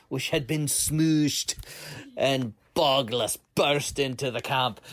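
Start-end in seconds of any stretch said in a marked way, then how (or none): none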